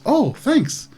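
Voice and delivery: high, innocent character voice; simple character chirping gratitude; Glub's thanks. Acted. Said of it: "He takes it and goes".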